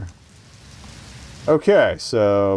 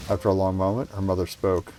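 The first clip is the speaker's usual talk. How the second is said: ordinary talk, nothing marked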